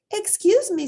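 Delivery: high mock-polite mother voice; mother teasing her son; mom playing along. A narrator's reading. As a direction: pretend not to know him. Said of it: "said Mom, knowing who it was".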